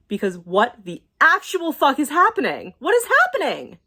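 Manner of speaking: a real, furious cry of disbelief; exasperated outburst. Real, not acted. To viewers about the laws, an exasperated outburst. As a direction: voice jumps up in disbelief at the end.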